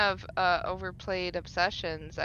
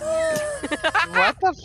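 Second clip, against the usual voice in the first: scream sound effect; 7 dB louder than usual. Pretend.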